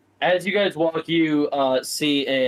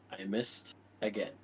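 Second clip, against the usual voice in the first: quiet grumble; 6 semitones lower, 14 dB quieter than usual. Acted. Character grumbling after a miss.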